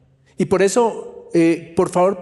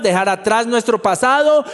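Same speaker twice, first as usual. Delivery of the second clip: ordinary talk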